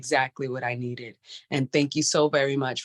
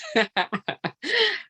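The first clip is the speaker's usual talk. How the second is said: emotional laugh; 8 semitones higher than usual. Real, not acted. Emotional caller overwhelmed by praise.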